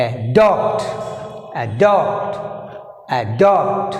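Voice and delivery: "adopt" split, with DOPT stronger; stress on second syllable. Shown as a demonstration. Teacher drilling stress mark, stressed DOPT in adopt. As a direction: hold back, then hit DOPT in "adopt". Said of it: "Here second syllable is adopt".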